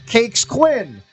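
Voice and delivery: usual speaking voice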